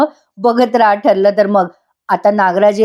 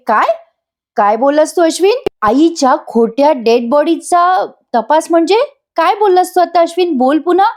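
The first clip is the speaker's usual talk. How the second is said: higher, insistent demand to repeat it; sharp confrontation; 7 semitones higher than usual. Acted. Priya confronting Ashwin about the fake body, higher and insistent. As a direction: press him hard to say it again.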